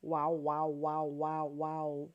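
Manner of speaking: mic-check wows into the repositioned mic; repeated wows checking the sound; mic test. Real, not acted. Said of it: "while I talk this way".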